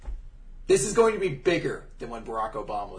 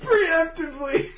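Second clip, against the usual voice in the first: high, loud, laughing through tears; crying with laughter; 14 semitones higher, 7 dB louder than usual. Real, not acted. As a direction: talk while helpless with laughter.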